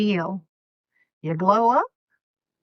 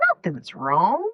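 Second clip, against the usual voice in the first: ordinary talk, nothing marked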